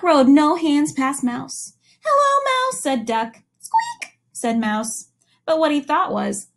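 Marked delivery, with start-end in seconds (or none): 2.0-2.8 high sing-song hello; a playful high-pitched hello; high friendly greeting. Acted. Duck greets mouse. 3.6-4.2 very high tiny squeak; a high, tiny mouse squeak; squeaky mouse. Acted. Mouse's line in story.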